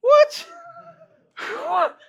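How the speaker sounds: high shocked yelp. Real, not acted. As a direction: gasp it out in shock. Bill dissolving in water.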